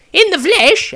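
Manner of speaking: put-on young Maestro. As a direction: still amused, pressing for an answer.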